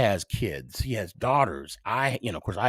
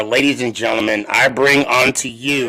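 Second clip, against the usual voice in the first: louder, fast showman introduction; mock announcer; 7 dB louder than usual. Host bringing on the Pizza Man's son, louder hammed-up announcer call. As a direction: present the guest like a ring announcer.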